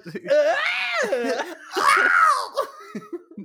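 high, loud laughing scream attempt; Wilhelm scream. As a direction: try a movie Wilhelm scream and crack up. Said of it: "attempted the Wilhelm scream".

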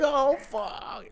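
high, thin drawn-out cry; imitating a reaction mid-flip; mock panic. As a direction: wail it softly in mock alarm. Reenacting flips in free fall.

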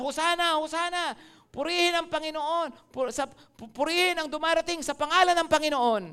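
joyful crowd shout. Retold or imitated. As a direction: ring it out like the crowd. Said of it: "sabi nila".

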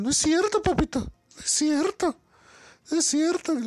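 high, sing-song mock denial to a sugar daddy; mocking the neighbor women. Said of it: "decían". (imitación burlona de voz aguda y melosa)

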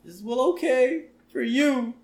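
put-on high, meek child voice; put-on high kid voice; timid little boy. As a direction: give in reluctantly as a small boy. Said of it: "A little sissy boy who's too afraid".

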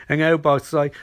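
ordinary talk

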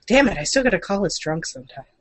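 usual speaking voice

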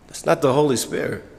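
ordinary delivery

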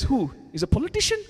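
high, loud puzzled question; puzzled reply. Retold or imitated. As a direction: sound baffled, rising at the end. Voicing Ed's puzzled reply.

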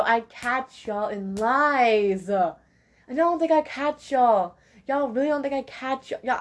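drawn-out, knowing, taunting tone; slow taunting call-out. Real, not acted. Calling out her viewers for lying, slow taunting. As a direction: let them know you see through it.

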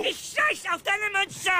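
high, wild shouting; frantic, high-pitched outburst; furious defiance. Acted. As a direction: reject the verdict in a screamed rage. Refuses the losing coin.

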